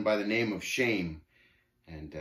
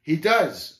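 ordinary delivery, nothing marked

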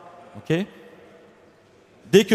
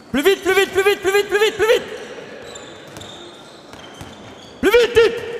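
very high, driving repeated shouts; insistent urging; 12 semitones higher than usual. Real, not acted. Coach demanding more speed from players, insistent urging. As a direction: keep pushing them, pitch rising to a peak.

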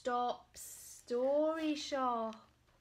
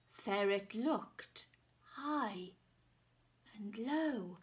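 ordinary delivery

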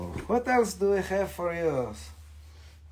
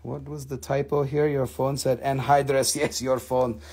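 normal talking voice

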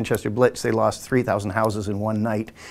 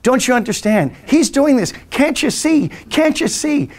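urgent friend; 10 semitones higher, slower than usual. Retold or imitated. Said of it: "He said".

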